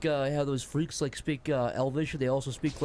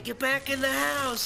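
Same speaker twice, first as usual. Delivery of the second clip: worried wife; 9 semitones higher, slower than usual. Acted. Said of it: "And his wife is saying".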